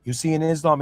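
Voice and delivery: ordinary talk, nothing marked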